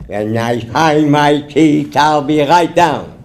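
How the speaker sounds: slow, gummy toothless reply; drawn-out toothless mumble; toothless husband. Acted. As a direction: answer unhurriedly as if your teeth are out. Toothless man answers slowly.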